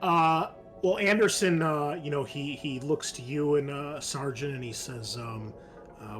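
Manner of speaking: ordinary talk, nothing marked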